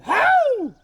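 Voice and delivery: long, high howl at full volume; yelling. Retold or imitated. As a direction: howl it out to let it go. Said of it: "I start yelling things like".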